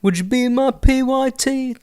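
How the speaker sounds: high, slow mock British singing accent. Imitating [name]'s thick British accent, high and slow.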